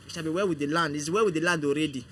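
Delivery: ordinary delivery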